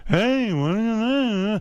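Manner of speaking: high, slow, drawn-out comedian voice; Jerry Seinfeld. Shown as a demonstration. Host trying his own comedian impression, high and slow. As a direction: attempt a whiny observational comic, stretched out. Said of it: "'cause I do".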